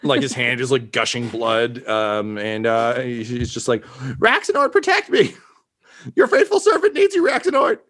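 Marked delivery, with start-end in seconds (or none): 4.2-7.8 high, loud desperate plea; panicked pleading. Acted. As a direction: beg your god in a panic. NPC begs his god.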